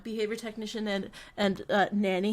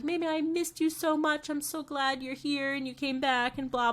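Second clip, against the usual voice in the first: imitating Yaya; 5 semitones higher than usual. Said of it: "Yaya will be like".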